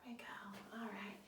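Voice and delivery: soft unvoiced whisper; gentle whispered praise. Real, not acted. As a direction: hush it after the breath. After breathing exercise, gentle.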